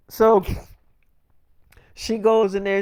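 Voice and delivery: ordinary delivery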